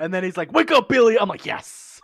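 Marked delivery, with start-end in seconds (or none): 0.5-1.2 high, loud quoted cry; mock urgent shout. Podcaster quoting the film to a co-host, mock shout. Quoting the film's wake-up call. 1.4-2.0 voiceless, excited exclamation; whispered cheer. Retold or imitated. Viewer reliving the joke landing, whispered cheer. Acting out own delighted reaction.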